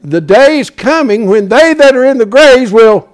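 high, loud scriptural proclamation; full-force preaching. Acted. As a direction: proclaim it to the whole church, stretching the end.

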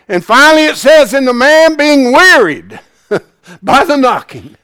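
preaching build. Real, not acted. Preacher retelling the parable to the church, building up. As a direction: lift it into preaching again.

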